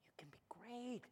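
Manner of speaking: hushed, breath-only encouragement; very soft, intimate assurance; whispered promise. Real, not acted. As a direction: whisper it to the congregation like a secret.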